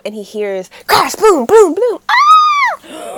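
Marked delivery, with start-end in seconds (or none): 1.1-2.0 loud booming imitated crashes; crash sound effects. Retelling the fight at home with loud booms. 2.1-3.2 high, loud startled shriek; a sudden hammed-up yelp; mock scream. Retelling the crash, a loud mock scream.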